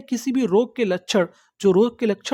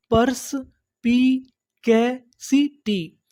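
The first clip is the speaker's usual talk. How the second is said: "परस्पिकैसिटी" very slowly with gaps between the pieces; broken into syllables; much slower than usual. Shown as a demonstration. Teacher building up a new word for learners, syllable by syllable. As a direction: sound out "परस्पिकैसिटी" chunk by chunk. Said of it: "टुकड़ों में तोड़-तोड़ के पढ़ना है".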